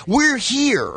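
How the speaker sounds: high, mock-exasperated outburst; exasperated listener. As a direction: call it out to a rambling storyteller. Acted thought of a bored listener.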